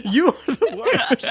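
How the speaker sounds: slow, laughing teasing. Real, not acted. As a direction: laugh out the words. Laughing at partner.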